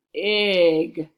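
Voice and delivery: BIG boomed out loud and long; emphatic big word. A narrator's reading. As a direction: make BIG huge and drawn out. Reads enlarged printed word aloud.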